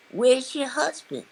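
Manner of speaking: playful impression of a kind older lady. Quoting [name]'s question.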